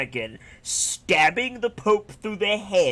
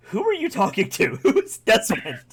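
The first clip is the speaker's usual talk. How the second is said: imitated confused question, pitched up through laughter; mock bafflement; 5 semitones higher, 4 dB louder than usual. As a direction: play baffled, half laughing. Acting baffled Ezio's reply, laughing.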